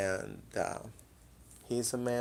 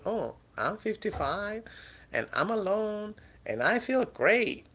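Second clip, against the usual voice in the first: high mock-cheerful voice of a lonely woman; mocking quote; 9 semitones higher, 5 dB louder than usual. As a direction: parody a fake-happy single woman, pitched up. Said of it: "I'm not gonna be like those bitches like".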